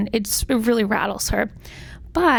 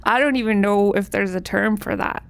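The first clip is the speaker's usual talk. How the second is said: usual speaking voice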